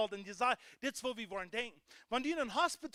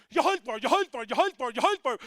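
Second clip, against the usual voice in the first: loud, brushing-off ja, halt mal; mocking dismissal; 10 dB louder than usual. Preacher mimics people brushing off the sick, loud and dismissive. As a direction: wave it off loudly over and over.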